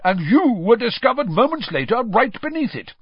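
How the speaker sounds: high, loud accusation; stern. A narrator's reading. Official demanding an explanation from the elf, stern. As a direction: press sternly for an answer. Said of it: "said Mister Diggory sternly".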